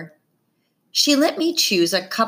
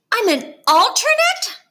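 much higher, louder, stunned; gasped shock; 5 dB louder than usual. A narrator's reading. Girl hearing she is only an alternate, shocked gasp. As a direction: gasp it out in disbelief. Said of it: "Claire gasped".

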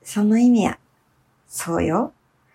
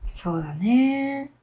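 usual speaking voice

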